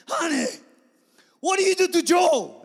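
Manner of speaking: high, very loud imitated scream; screaming wife. Wife demanding answers about Joe, imitated scream. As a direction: yell it accusingly, as the upset wife. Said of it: "When my wife's screaming, I'm scared".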